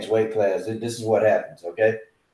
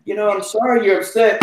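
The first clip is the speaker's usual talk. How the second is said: higher, placating customer-service voice; lightly voiced apologetic phone agent; mimicking phone agent; 8 semitones higher than usual. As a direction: soothe him like a nervous phone rep. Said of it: "And she said".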